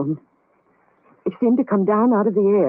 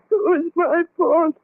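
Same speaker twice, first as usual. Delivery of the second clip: guilt-stricken; 7 semitones higher, slower than usual. Acted. Blaming herself for the death.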